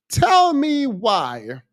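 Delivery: high, drawn-out exasperated demand. Real, not acted. Exasperated at the person's argument.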